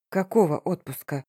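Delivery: ordinary delivery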